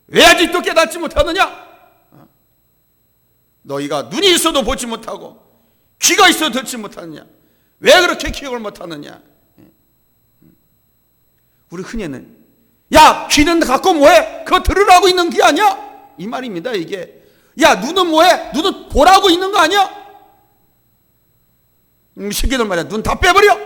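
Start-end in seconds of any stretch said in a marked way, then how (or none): 0.1-9.2 loud, high-pitched rebuke in questions; stern scolding. Acted. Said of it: "야단치는 내용이 나옵니다". 12.9-16.1 loud, high, exasperated telling-off; angry scolding. Acted. Jesus scolding disciples who will not listen, angry scolding. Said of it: "막 화를 내신 거예요". 17.6-23.6 high, loud outburst of exasperation; angry scolding. Acted. Jesus scolding disciples who will not see, angry scolding. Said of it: "막 화를 내신 거예요".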